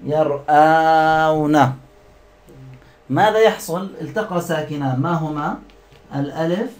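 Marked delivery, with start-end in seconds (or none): 0.0-1.7 drawn-out word. Shown as a demonstration. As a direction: hold the long آ in يرآون very slowly. Teacher sounds out intermediate form. (مدّ الألف في يرآون ببطء)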